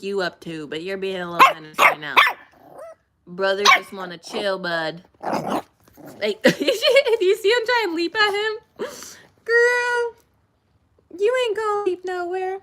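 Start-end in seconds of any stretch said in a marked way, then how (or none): 6.2-12.6 put-on much higher, laughing baby talk; high pet voice. Owner teasing her leaping dog, high baby talk. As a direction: squeal it at the puppy.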